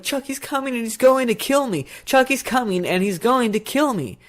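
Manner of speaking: put-on high, urgent child's warning; mock-panicked kid pleading to be believed; imitated yelling boy. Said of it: "he's, like, yelling out of the door".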